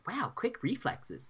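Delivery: dry impressed remark. Retold or imitated. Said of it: "I was just like".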